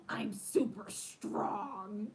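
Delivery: drawn-out, strained strongman boast; boastful little kid. Retold or imitated. Daughter tells mom why she is Luisa, proud and mighty. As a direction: stretch it out slowly like a child showing muscles. Child flexing as Encanto's Luisa.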